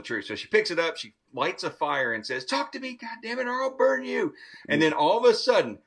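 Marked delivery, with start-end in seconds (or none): 2.4-4.3 high, strained threat; mock threat. Mother threatening the doll over a fire, high and strained.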